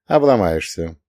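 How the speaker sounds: ordinary delivery, nothing marked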